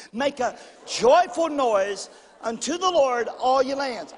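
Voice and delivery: slow, emphatic verse reading; led scripture recitation. Acted. Preacher leading the congregation in Psalms, slow emphatic recitation. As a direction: proclaim each word for a crowd to follow.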